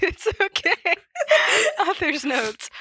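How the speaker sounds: high, breaking into laughter; giggling through the words; laughing. Real, not acted. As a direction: try to read but keep laughing. Laughing while starting to read.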